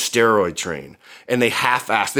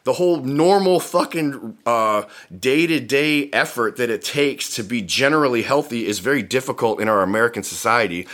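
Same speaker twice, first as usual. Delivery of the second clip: ordinary talk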